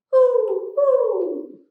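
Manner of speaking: high hooting owl call. Shown as a demonstration. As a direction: hoot like an owl for the kids. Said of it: "What is an owl saying?".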